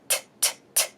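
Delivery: crisp voiceless tongue-tap "t", repeated with gaps; isolated T sounds. Shown as a demonstration. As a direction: tap out the bare T consonant softly, three times. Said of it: "the change of S and T".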